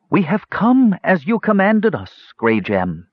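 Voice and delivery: high, slow proclamation; ceremonial cry. A narrator's reading. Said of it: "cried a voice".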